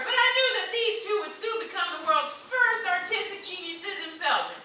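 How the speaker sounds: ordinary delivery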